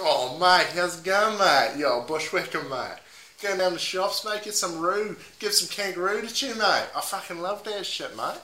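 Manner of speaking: ordinary Australian bloke voice; run-of-the-mill Aussie. Acted. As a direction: play an everyday Aussie mate. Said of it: "give me your run-of-the-mill Aussie".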